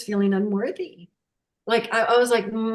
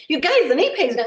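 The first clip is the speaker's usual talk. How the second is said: higher and louder, calling out the good news; imitating mom telling everyone; mom's excited announcement; 8 semitones higher, 5 dB louder, faster than usual.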